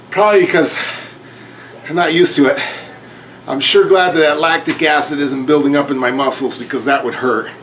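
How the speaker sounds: ordinary talk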